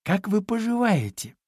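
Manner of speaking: polite piglet. A narrator's reading. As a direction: greet her politely, voice lifted. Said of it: "вежливо поклонился ей Чуня". (вежливо, тоненьким голоском)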